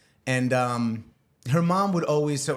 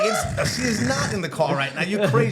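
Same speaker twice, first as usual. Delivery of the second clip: high, loud, laughing-off denial; panicked denial; 7 semitones higher, 3 dB louder than usual. Retold or imitated. Man to his boss, a high nervous laughing denial. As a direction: laugh it off, too hard.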